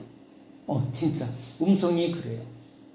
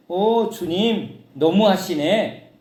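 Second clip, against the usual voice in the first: slow, drawn-out, higher whining complaint; mock whine; 4 semitones higher, 5 dB louder, much slower than usual. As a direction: stretch it out like a sulky plea to God. Whining to the Lord. (느리게 늘어지는 투정)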